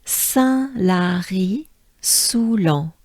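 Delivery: "Saint-Lary-Soulan" stretched syllable by syllable; very slow group repeat. Shown as a demonstration. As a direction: lead the class through "Saint-Lary-Soulan" very slowly. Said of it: "let's do it together".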